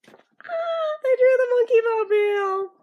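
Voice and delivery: loud, high fan squeal; excited shout. Real, not acted. Monkees fan spotting the monkey mobile, shouting with delight. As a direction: burst out with fan joy. Fan spots beloved car.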